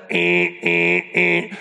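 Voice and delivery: deep, droning imitation of an alarm buzzer; alarm clock buzz. Imitating the alarm clock that woke his wife, low droning buzz. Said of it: "the old school alarm clock that when it goes off".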